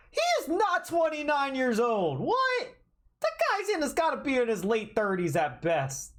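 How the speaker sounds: high, disbelieving protest; can't believe the age listed; incredulous outburst. Real, not acted. Outraged at character age.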